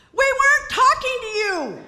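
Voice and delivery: very high, loud scream; shrill mocking scream of the voices; voices scream back. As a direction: screech it back at her. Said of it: "the voices screamed back".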